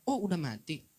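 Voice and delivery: casual "oh, he's dead", as if nothing happened; offhand indifference. Retold or imitated. Nurse noting a patient's death, mildly offhand. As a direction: toss it off like the death means nothing. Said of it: "Kayak gitu caranya ngomongnya loh".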